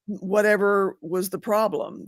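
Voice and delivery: usual speaking voice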